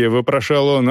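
ordinary delivery, nothing marked

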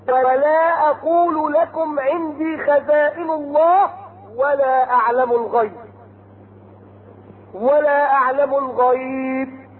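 slow chanted verse; Quran recitation. Acted. Preacher reciting Noah's words to his people, chanted. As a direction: intone it like a reciter.

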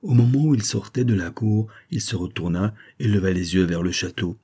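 ordinary delivery

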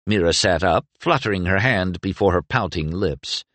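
plain reading voice

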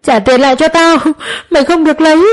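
high, tearful pleading to the thief; nearly crying. A narrator's reading. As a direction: beg as if about to cry. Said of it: "Bà nói như khóc". (nói như sắp khóc, giọng cao)

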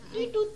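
sudden squeaky high call; high code sound. Shown as a demonstration. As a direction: chirp the signal high. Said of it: "Pokok tidut".

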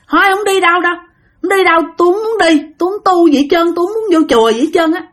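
high, protesting whine of refusal; stubborn refusal. Retold or imitated. Spirit refusing to become a monk, stubborn. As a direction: refuse flat out, pitched high.

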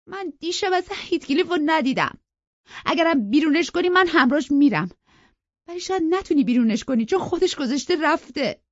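very high, loud wailing defiance; tearful protest. A narrator's reading. Sister defying her brother over banishing Heathcliff, crying. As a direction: wail it out, pitched right at the top. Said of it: "زد زیر گریه و با حقه گفت".

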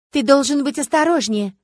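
high, loud soap-opera blonde; melodramatic warning. A narrator's reading. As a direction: warn your lover with overwrought urgency. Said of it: "отчаянно жестикулируя, предупредила платиновая блондинка". (театрально предостерегая, высоким голосом)

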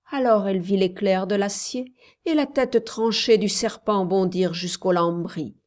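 ordinary delivery, nothing marked

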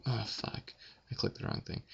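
hushed frustrated whisper. Real, not acted. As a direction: whisper it annoyed. Whispered mistake.

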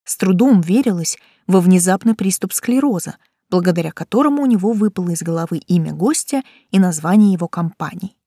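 plain reading voice

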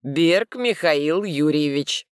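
slow, pressing each name; emphatic. Acted. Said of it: "с нажимом добавляет".

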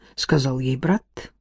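usual speaking voice

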